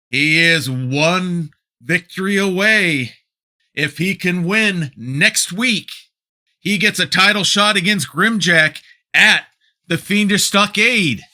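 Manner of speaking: loud, excited play-by-play call; wrestling commentator. Acted. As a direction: call the match like a ringside commentator. Calls the match play-by-play.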